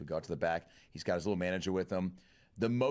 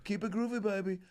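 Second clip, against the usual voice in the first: much higher, breezy thanks; mock rock star signing off, sing-song; 11 semitones higher than usual.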